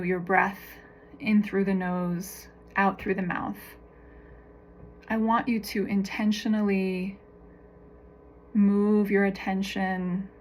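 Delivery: calm, measured meditation voice with long pauses; guided meditation. Real, not acted. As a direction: guide them gently through each breath and image, unhurried.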